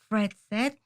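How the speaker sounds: plain reading voice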